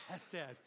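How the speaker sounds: Chesed with a harsh, spitting ch from the throat; throat-clearing ch. Shown as a demonstration. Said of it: "Clear your throat like you're gonna spit".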